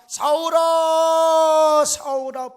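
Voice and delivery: the divine voice calling Saul by name; voice from heaven. Acted. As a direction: call down from the sky like God summoning. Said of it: "하늘에서 음성이 들립니다".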